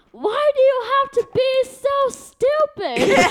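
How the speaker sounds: high, slow, drawn-out exasperation; mock exasperated mom. Daughter mimics mom's tone toward forgetful dad, high exaggerated exasperation. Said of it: "The, the tone and her demeanor was this way".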